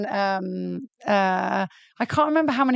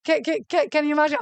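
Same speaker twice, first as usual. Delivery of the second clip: pretend excitement; 6 semitones higher, faster than usual. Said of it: "I'll be like".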